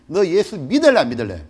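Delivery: loud, high furious shouting; gunman's threat. Retold or imitated. Gunman demanding he renounce Jesus, furious shout. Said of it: "저한테 그러는 거야".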